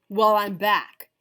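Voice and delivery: loud gruff bully voice; loud growling return; growled. Acted. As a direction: growl it like a big mean dog. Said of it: "growled Big Bark".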